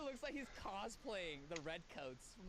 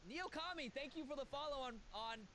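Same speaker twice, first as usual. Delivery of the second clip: usual speaking voice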